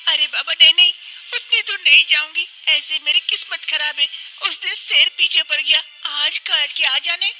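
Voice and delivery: put-on high-pitched young wife, breathless and relieved; young wife explaining. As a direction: tell her mother-in-law how you escaped. (ऊँची आवाज़ में बहू, राहत भरी)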